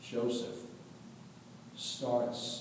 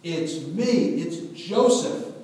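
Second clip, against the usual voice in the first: higher, louder insistent reveal; 6 semitones higher, 8 dB louder, slower than usual. Acted. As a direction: make them see you. Joseph reveals himself again.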